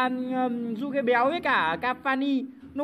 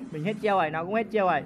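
ordinary talk

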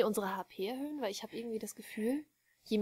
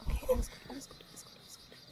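hushed reassuring whisper; whispering while hiding; 14 semitones higher, 5 dB quieter, faster than usual. Real, not acted. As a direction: whisper it to calm your partner. Calming while monster passes.

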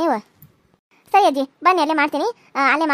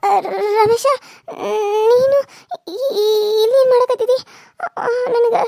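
crying; 5 semitones higher than usual. Acted. Raji, recognising Ramesh, cries out to him through tears. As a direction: burst into tears at the sight of him. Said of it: "ಈ ಕಣ್ಣೀರಿಗೆಲ್ಲ ನಾನು ಕರಗೋದಿಲ್ಲ".

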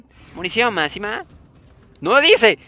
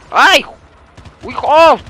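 sudden high yelps of alarm; real startled cries; 11 semitones higher, 11 dB louder, much slower than usual. Real, not acted. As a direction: jump at the scare. Zombies attack in game.

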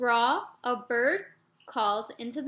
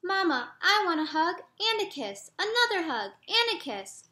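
put-on high little boy's voice, asking sweetly; a small frog begging his mama for another hug; small child pleading; 7 semitones higher than usual. Little Jeremy asks his mama for a hug, high childlike voice.

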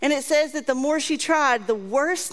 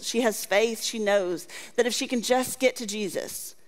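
usual speaking voice

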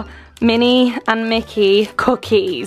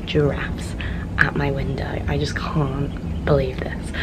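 whispering; 8 semitones lower, 5 dB quieter than usual. Real, not acted. Hushed morning talk.

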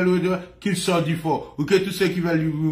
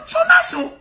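excited shout; 4 dB louder than usual. Real, not acted. Excited outburst with laughter.

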